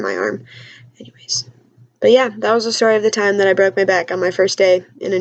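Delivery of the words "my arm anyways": lower, whispered aside; trailing whisper. Real, not acted. Wrapping up the pain story to viewers, in a whisper. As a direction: let it fade into a whisper.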